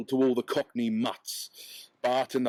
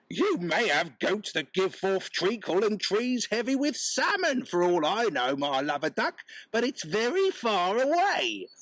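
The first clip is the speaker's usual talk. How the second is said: higher, mocking Cockney market wife; 8 semitones higher than usual. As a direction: brush him off, sweetly sarcastic. Wife answers peddler.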